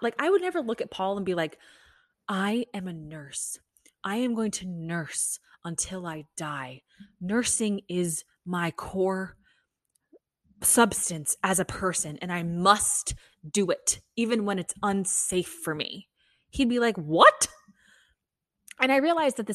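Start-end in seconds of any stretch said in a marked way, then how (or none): 2.3-16.1 slow, weighty pretend pledge; mock-solemn declaration. Mock solemn vow as a nurse. 17.0-17.5 loud, incredulous mock outburst. Imagined husband's incredulous reply.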